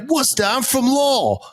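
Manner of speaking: excited Boston local; Boston accent. Retold or imitated. Driver saying he's from Lowell, Boston accent. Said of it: "switch accents quick".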